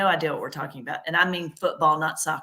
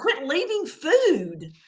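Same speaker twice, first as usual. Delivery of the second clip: exasperated; 9 semitones higher, much slower than usual. Retold or imitated. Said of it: "I was like".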